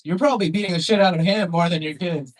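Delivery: ordinary talk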